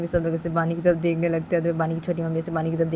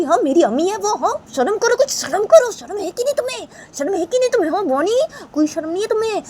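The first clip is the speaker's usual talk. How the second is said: angry scolding; 7 semitones higher than usual. Acted. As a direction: shame her and threaten to kill her. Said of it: "तो राम से बोलती है बानी को". (ऊँची, गुस्से भरी डाँट और धमकी)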